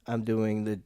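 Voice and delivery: usual speaking voice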